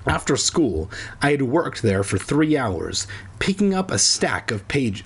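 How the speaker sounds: plain reading voice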